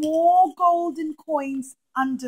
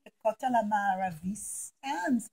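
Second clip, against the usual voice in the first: usual speaking voice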